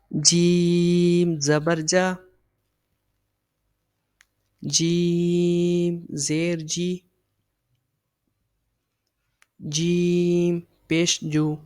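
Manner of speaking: very slow, drawn-out syllable drill; very slow recitation. Shown as a demonstration. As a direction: sound out each syllable slowly for the learners. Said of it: "نہ لمبا کھینچنا ہے". (بہت آہستہ سبق پڑھانا)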